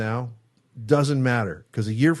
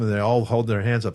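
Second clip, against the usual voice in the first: normal talking voice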